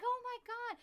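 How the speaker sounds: very high, loud gush; imitated delight. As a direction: mimic a woman thrilled by a coincidence. Retells the lady's delighted reaction.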